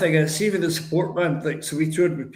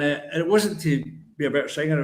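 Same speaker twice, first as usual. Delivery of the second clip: ordinary delivery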